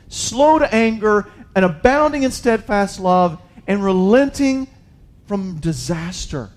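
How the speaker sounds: preacher reading scripture with rising force; preaching scripture at full force. Acted. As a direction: proclaim Jonah's prayer to the congregation. Reads Jonah's prayer aloud.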